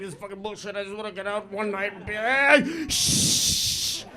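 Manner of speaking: mutter, then shush the row; mock irritated patron; annoyed cinemagoer.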